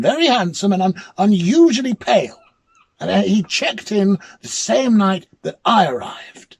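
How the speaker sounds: loud, high, urgent insistence; insistent protest. Acted. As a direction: push hard to convince the clerk. Insisting to disbelieving hotel clerk.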